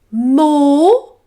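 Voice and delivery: high, loud teacher dragon; a booming schoolmistress dragon calling from the sky; Madam Dragon. Acted.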